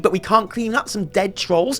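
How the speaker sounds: normal talking voice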